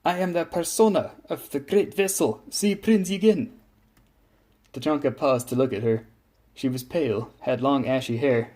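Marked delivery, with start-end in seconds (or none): 0.0-3.5 put-on high, haughty German-accented announcement; sigh, then proclaim yourself grandly; imperious German ship lady; arrogant impatience. A narrator's reading. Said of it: "replied with a tone of arrogant impatience".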